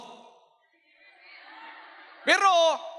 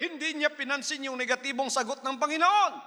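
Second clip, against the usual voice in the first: ordinary talk, nothing marked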